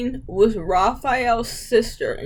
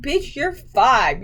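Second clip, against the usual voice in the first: normal talking voice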